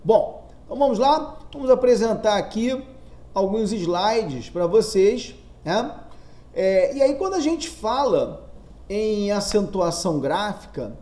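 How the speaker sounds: usual speaking voice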